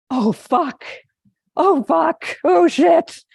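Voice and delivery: high, loud, rising alarm at a mountain lion; panicked cursing. Retold or imitated. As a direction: blurt it out in retold panic. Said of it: "the first thing he says is".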